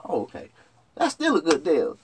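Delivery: normal talking voice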